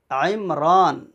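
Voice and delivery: "عمران" with a clear throaty ain and long raa; careful, drawn "عمران"; clean ain. Shown as a demonstration. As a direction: articulate the ain of "عمران", stretch the aa. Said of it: "صفائی کے ساتھ عین کو نکالنا".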